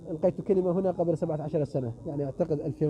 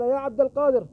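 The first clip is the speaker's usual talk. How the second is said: put-on higher, louder drunkard's question; drunk man's challenge; 5 semitones higher, 4 dB louder than usual. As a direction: press the question hard as the drunk. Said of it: "قال".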